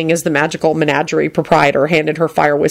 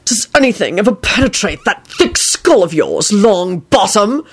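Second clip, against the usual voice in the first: Snape shouting; 3 semitones higher than usual. A narrator's reading. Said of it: "Snape shouted from the card".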